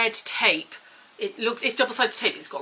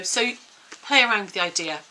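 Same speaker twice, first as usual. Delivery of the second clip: usual speaking voice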